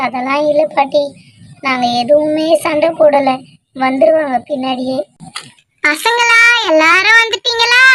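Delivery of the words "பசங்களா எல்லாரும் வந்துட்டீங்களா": put-on higher, calling across to the kids; grandma hailing the children.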